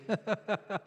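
short laugh; real chuckle. Real, not acted. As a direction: chuckle at your own quip. Laughs at his own quip.

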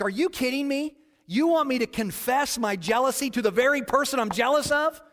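high, loud indignant protest; mad, incredulous. Retold or imitated. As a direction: throw the demand back in outraged disbelief. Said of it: "but I got mad".

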